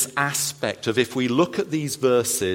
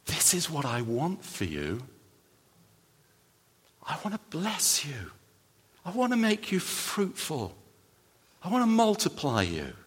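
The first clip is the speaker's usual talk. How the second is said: soft, gentle, higher voice of a loving God; God's tender blessing; 4 semitones higher, 6 dB quieter than usual. Acted. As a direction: offer it quietly like a gift. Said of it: "The blessing is".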